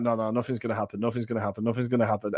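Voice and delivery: retold calm denial, repeated evenly; imitated dismissive uncle. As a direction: brush it off quietly, as the uncle. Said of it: "the desperation kind of getting in his voice".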